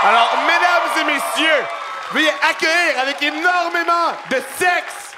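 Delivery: grand entrance announcement. Acted. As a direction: announce the star guest like a showman. Host announces guest entrance.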